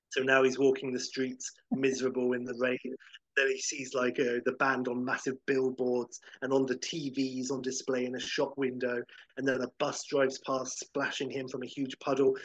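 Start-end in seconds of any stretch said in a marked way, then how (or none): none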